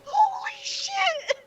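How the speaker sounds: very high, slow squeal through laughter; real thrilled shock. Real, not acted. Player stunned by the betrayal, high laughing squeal. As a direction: squeal it out, astonished.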